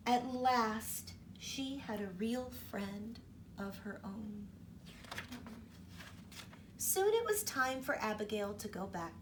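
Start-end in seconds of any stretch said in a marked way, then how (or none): none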